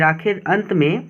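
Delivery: ordinary talk, nothing marked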